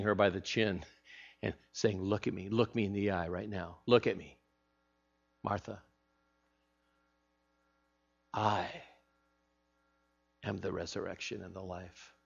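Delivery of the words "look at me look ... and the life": soft, intimate and earnest; intimate Jesus voice. Acted. Jesus speaking softly to Martha, eye to eye. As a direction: hold her gaze and speak low.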